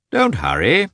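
high, unhurried doctor voice; lightly voiced doctor, calm and teasing. A narrator's reading. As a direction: soothe him, no hurry. Calm doctor greets anxious husband.

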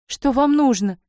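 high, indignant cry; shouted question. A narrator's reading. Young lady running out to confront the Tatar, shouted question. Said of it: "кричит татарину".

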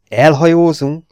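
magasan, hangosan, ijedten kérdezve; megrémülve felsikolt; rémült sikoly. A narrator's reading. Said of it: "sikított rémülten". (ijedt, magas, hangos kérdés)

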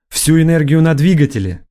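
high, urgent shouted order; shouted command. A narrator's reading. Kyoln to her bridge crew under attack, shouting. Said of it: "закричала Кёльн".

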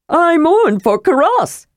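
high, slow shrill call of a small kender; shrill cry. Acted. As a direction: sing it out high and piercing like an excited kender. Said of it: "cried a shrill voice".

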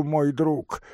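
ordinary delivery

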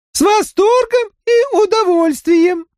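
very high monotone alien voice; high, flat and mechanical, like a crab creature. Acted. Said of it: "сказал Краб".

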